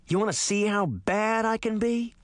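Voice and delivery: a touch of growl in a rising threat; threatening growl. A narrator's reading. Puck threatening Sabrina, growling lightly. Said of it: "he growled".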